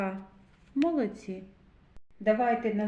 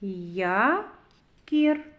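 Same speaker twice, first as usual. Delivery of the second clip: Якір split into Я, pause, КІР; syllable by syllable. Shown as a demonstration. Teacher reads with children, syllable by syllable. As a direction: read Якір in two separated syllables, Я – кір. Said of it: "Давайте прочитаємо".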